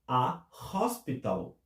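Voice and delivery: A HOSPITAL slowly, with an audible breathed H; aspirated H. Shown as a demonstration. Teacher translating um hospital, slow aspirated H. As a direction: separate A, then breathe into HOSPITAL. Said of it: "porque tem esse som do H".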